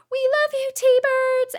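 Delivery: very high, loud stadium cheer; cheering band parents. Retold or imitated. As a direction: shout it from the bleachers. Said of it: "they go".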